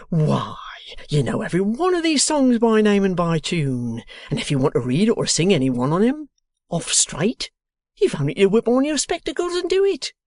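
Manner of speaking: put-on loud, high, excited old man; cried out. A narrator's reading. Boffin praising Wegg's learning, loud and excited. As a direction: burst out in admiration. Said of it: "cried Mister Boffin".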